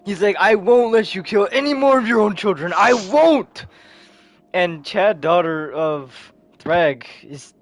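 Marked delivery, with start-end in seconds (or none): 0.4-3.7 raised, heated heroic vow; acted defiance, high and loud; defiant shout. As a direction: refuse forcefully, building to a cry. Hero defiantly quoted in fight.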